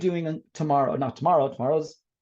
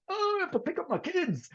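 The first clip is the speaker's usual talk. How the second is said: much higher, put-on frantic voice; stressed attendee; 3 dB quieter than usual. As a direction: squeak it out like a trapped teacher itching to leave. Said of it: "and you're like".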